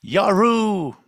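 high, whooping hello to the listeners; goofy greeting. Acted. Co-host greeting the audience with a high whoop. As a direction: toss out a silly, high cheer. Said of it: "Say hello to all the people".

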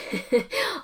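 a short amused laugh. Real, not acted. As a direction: laugh at the idea that just popped up. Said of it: "I'm laughing because".

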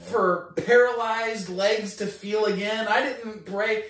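loud, high, stretched-out protest; incredulous protest. Retold or imitated. Caller baffled by an unasked-for healing, incredulous. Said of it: "I'm like".

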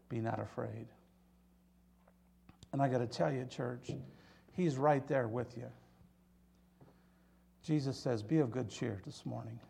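soft, low reassurance; hushed preaching. Acted. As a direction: drop right down and speak gently to the frightened. Preacher comforts the church quietly.

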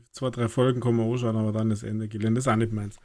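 normal talking voice

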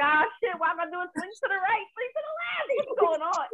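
high-pitched, baffled self-questioning; mock bewilderment. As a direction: act out hopeless confusion, voice shooting up. Acts out botched dance confusion.

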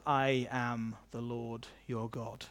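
slow, low, hushed authority; softly and gravely, every word given room; God speaking. Acted. God claiming his complaining people, slow quiet authority. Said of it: "And God says".

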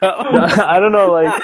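slow laughing, high and loud; drawn-out sheepish giggle; laughing off embarrassment. Real, not acted. Laughs after being teased.

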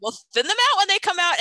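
high, loud, quick placating voice; retold over-bright reassurance. Mom after kid spilled carrot seeds, quick high mock reassurance. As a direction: rush out the fix in a high pitch.

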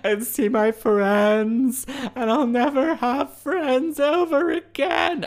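high, loud dramatic lament, slowing at the end; mock melodramatic wail. Recalling her tearful drive, a hammed-up loud lament. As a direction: despair theatrically over lost summers. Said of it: "I was like".

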